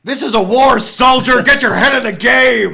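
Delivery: loud, high barked command; drill-sergeant yell. Acted. Mock-yelling at a soldier in a war, drill-sergeant yell. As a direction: bellow it like a hammed-up sergeant. Acted military pep yell.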